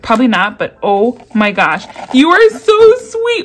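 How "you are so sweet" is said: high, loud, slow gush of thanks; touched gush. Real, not acted. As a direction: pour it out, deeply touched. Thanking the gift-giver.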